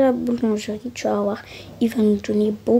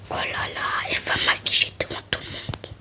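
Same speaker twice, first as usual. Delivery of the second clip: softer aside, whispered, to mom; 8 dB quieter than usual. Acted. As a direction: hiss it under your breath, embarrassed. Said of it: "Mais parle doucement". (chuchoté, gênée par sa mère)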